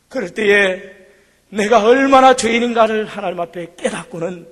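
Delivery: ordinary delivery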